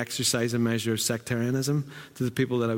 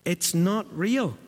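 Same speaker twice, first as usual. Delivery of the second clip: usual speaking voice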